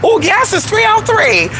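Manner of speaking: squealed way up high; delighted surprise. Real, not acted. Spotting cheap gas on the road, a high delighted squeal. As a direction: shriek with joy at the price.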